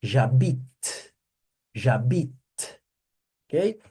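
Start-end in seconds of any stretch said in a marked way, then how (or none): 0.0-2.3 J'habite said zha-BEET, stopping on the T; pron J'habite. Shown as a demonstration. Teacher modelling the end sound for Brazilian students: zha-BEET ending on the T. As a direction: Jabit with the final T clipped. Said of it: "a pronúncia, ó".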